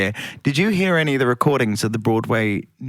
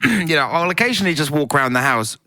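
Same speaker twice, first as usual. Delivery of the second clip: ordinary talk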